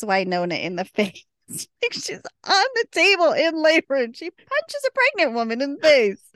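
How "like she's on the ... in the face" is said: higher, louder, incredulous outrage; outraged disbelief. Real, not acted. Telling her co-host about the punch, in shocked outrage.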